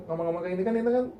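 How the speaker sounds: rushed, tripping over words; fast tangled talk. Shown as a demonstration. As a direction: gabble it fast, tongue tangling. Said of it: "lidahnya tuh kayak bisa belibet".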